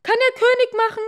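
hammed-up raging wife, fast; furious shout. A narrator's reading. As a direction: scream at him like an enraged queen. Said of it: "furious queen wife".

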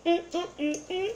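high happy mm-mm-mm; pleased humming. Real, not acted. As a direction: hum with delight. Tasting, humming approval.

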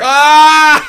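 long, loud open-throated yell; drawn-out holler; loud scream. Real, not acted. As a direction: scream it out at full volume. Screams as game mishap happens.

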